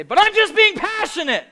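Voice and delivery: put-on high, loud childish protest; shouted protest. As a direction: yell it back like a defiant kid. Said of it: "And I would say".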